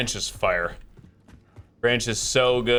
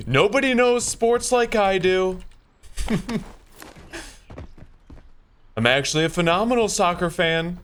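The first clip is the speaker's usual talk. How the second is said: a light Trump voice, raised and boastful; Trump impression; 6 semitones higher than usual. Gamer mocking Trump's boasting to friends, lightly voiced Trump. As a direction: brag like Trump, just a shade higher. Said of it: "the top tier Trump, like, voice there".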